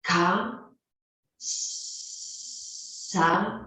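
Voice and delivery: halting "k, sa", stuck on each opening consonant before the vowel; prolonged first sound. Shown as a demonstration. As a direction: stretch the s of "sa" into a long hiss before releasing the vowel. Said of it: "una prolongación de consonantes y vocales".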